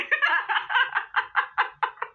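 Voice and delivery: high laugh. Real, not acted. Cracking up at the shade.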